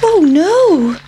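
a little pancake panicking; pancake's dismay. A narrator's reading. Pancake fearing the hungry boys, high squeak. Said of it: "thought the pancake".

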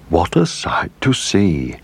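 slow, drawn-out character voice; declaring lobster. A narrator's reading. Lobster watching the rescue, slow declaration. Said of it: "declared a lobster".

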